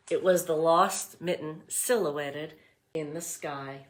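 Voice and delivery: put-on read-aloud voice for young children; storybook reading. A narrator's reading. Teacher reading The Mitten to class, storybook voice. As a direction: narrate it warmly for the class.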